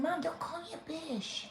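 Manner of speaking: high-pitched mimicked child's complaint; imitated daughter. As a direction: play the daughter protesting to mom. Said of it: "She said".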